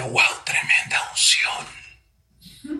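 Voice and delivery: awed near-whisper, drawn out slowly; hushed awe. Retold or imitated. Preacher recalling his thought at a weeping woman, hushed awe. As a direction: breathe it slowly, as a private marvel.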